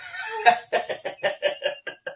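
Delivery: high, breathy mock cackle; hammed-up stuttering chuckle; imitated villain chuckle. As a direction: act out a long creepy chuckle. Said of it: "he chuckles. He goes".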